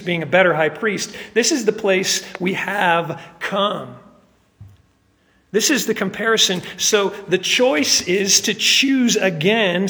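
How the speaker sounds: loud, high, driving sermon delivery; preaching at full force. Acted. Pastor urging the congregation about Jesus' blood, loud and rising. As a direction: build it like a revival preacher. Urges the church to choose.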